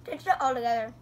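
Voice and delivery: talking while chewing candy; words muffled by food; mouth full, chewing. Real, not acted. Seen 1: Striped-shirt girl hand at mouth chewing; unclear which girl speaks.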